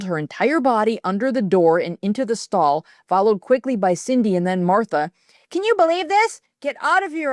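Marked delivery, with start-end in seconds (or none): 5.5-7.3 much higher, desperate yell. A narrator's reading. Trapped girl yelling at the girls crowding her stall. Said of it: "I yelled".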